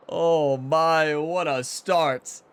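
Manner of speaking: loud, slow exclamation; excited. Real, not acted. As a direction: savor the great start. Delighted after birdie.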